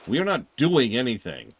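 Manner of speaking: firm shouted no; loud defiant refusal. Real, not acted. Player defying the game villain, loud. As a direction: plant your feet and yell it.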